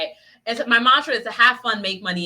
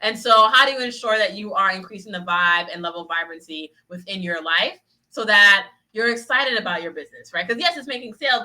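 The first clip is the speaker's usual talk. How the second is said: ordinary delivery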